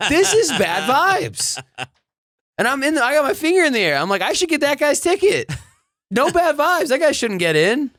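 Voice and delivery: high-pitched, worked-up storytelling; mock outrage. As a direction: play up indignation with the voice way up. Mock outrage at bad vibes.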